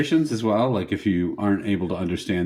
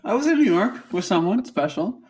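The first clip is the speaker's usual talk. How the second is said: coy high voice; 13 semitones higher, 4 dB louder, slower than usual. Pretend. Coy joking confession.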